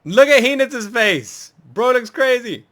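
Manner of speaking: high, loud and delighted; a loud, delighted reaction to a funny face; excited outburst. Real, not acted. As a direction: crack up at the face on screen. Laughing at a funny anime face.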